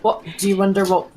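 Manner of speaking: ordinary delivery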